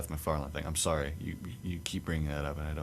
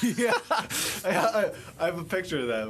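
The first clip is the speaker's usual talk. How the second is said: high, loud words broken by real laughter; giggling while picturing the scene; laughing; 13 semitones higher, 4 dB louder, slower than usual. Real, not acted. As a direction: laugh your way through the line. Laughing at friend's shield memory.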